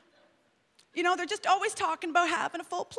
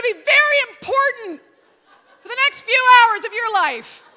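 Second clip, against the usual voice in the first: loud, high, laughing mock command; hammed-up urgent announcer; booming mock urgency; 7 semitones higher, 13 dB louder than usual. What kids hear, exaggerated.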